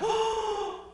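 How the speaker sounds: high, loud shocked oh; shocked gasp. Retold or imitated. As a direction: gasp as the crowd is stunned. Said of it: "everyone was like, gasps".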